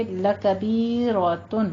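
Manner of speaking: لَكَبِيرَةٌ slow with heavy ر; tajweed demo. Shown as a demonstration. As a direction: give لَكَبِيرَةٌ a full thick ر. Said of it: "را کو پر پڑھنا ہے". (لَكَبِيرَةٌ میں را پر)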